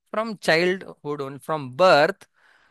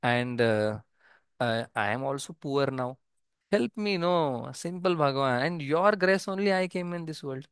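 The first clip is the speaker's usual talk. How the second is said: usual speaking voice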